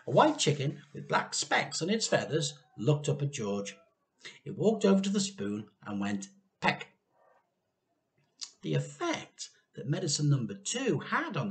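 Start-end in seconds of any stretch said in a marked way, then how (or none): none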